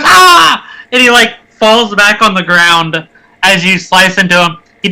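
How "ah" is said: short high yelp of pain; kobold pain cry. Acted. Kobold hit by the halberd, pained yelp. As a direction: cry out loudly as if struck. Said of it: "he just goes".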